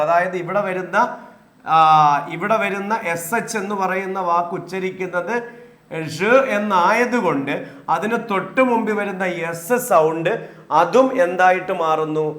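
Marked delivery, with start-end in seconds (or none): none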